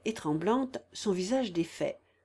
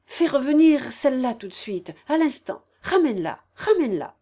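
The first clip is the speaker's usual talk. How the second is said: loud, raised command; impatient order; 5 semitones higher, 8 dB louder than usual. A narrator's reading. Woman orders the landlady to fetch her back, impatient order. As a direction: call out the order urgently, higher and louder.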